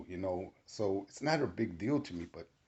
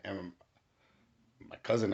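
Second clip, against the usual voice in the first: ordinary talk, nothing marked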